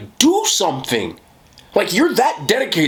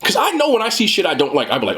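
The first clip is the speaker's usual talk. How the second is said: ordinary talk, nothing marked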